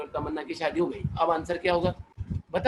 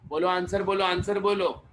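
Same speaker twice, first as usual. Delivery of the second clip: ordinary talk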